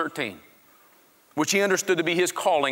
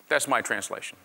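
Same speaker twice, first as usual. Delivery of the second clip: ordinary delivery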